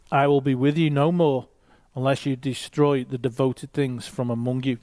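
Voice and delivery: normal talking voice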